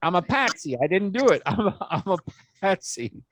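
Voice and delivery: high-pitched mock yell with a laugh; mock scream. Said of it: "he started screaming".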